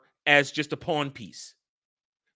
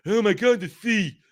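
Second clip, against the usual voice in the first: mock awe; 5 semitones higher than usual. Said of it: "they all stand there going".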